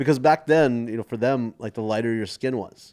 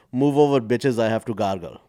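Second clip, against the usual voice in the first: louder, bolder nun; punchline of the nun; 2 semitones higher, 9 dB louder than usual. Retold or imitated. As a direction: deliver the punchline with swagger. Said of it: "and says".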